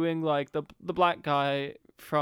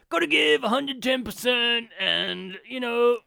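loud, high jock drone; high-pitched dumb jock spouting clichés; dumb guy voice; 6 semitones higher, 5 dB louder than usual. Retold or imitated. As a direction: ham up a dim athlete cliché. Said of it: "he does a dumb guy voice".

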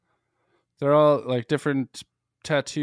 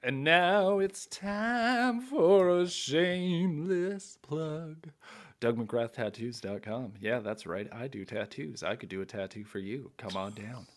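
slow, soft mock plug voice; ad announcer impression; 7 dB quieter than usual. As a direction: read it like a cheesy ad. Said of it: "Let me hear another impression".

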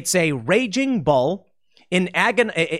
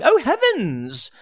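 higher, slow mock gasp; a prim, hammed-up dismay; shocked elites; 8 semitones higher, much slower than usual. Elites watch the bull smash their china, slow mock gasp. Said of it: "political elites going".

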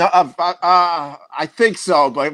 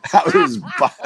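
mock echo; 9 semitones higher than usual. Host echoes the line mockingly.

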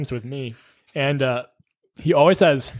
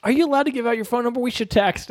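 eager flirty patient; 7 semitones higher, faster than usual. Retold or imitated. Patient asking for number.

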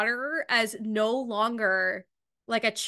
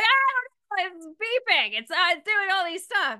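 higher, helpless mock mom voice; flustered mom; 7 semitones higher than usual. Mom calls about the broken printer, flustered and helpless. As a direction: play a mom baffled by the beeping printer. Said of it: "They're like".

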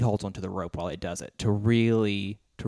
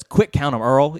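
urgent commentator; 5 semitones higher, 8 dB louder, slower than usual. Retold or imitated. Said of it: "And J R says".

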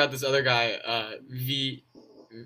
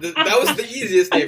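laughing. Real, not acted. Laughing at the mispronunciation.

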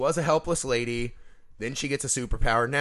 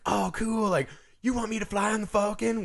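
high, giddy disbelief; imitated fangirl excitement; 10 semitones higher than usual. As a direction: play a starstruck pilot offered a job. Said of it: "when she's like".

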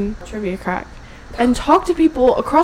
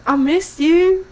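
higher, mock thick home accent; [name]; 7 semitones higher, 4 dB louder, slower than usual. Mimicking a returning friend's greeting, thick accent. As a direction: gush it in a thick imitated accent. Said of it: "it's gonna be so thick".

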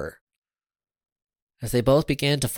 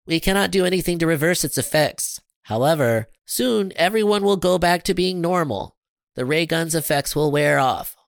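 slightly higher, matter-of-fact alien; lightly voiced alien explaining; 6 semitones higher, 3 dB louder than usual. A narrator's reading.

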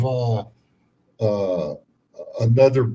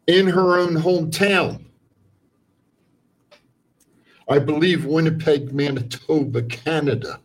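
rant show host; 3 semitones higher, 4 dB louder than usual. Acted. Continues the rant.

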